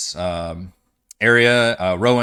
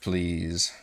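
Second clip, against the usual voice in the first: ordinary talk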